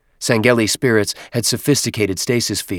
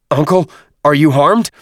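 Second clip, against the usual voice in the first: high, loud worried call; 8 semitones higher, 8 dB louder than usual. Acted. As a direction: shout it over the comm line. Tartarus calls over signal unit.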